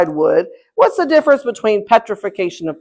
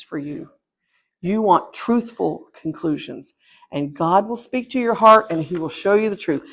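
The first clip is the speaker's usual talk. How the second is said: earnest preaching; 3 semitones lower, 5 dB quieter than usual. Acted. Earnest appeal to congregation.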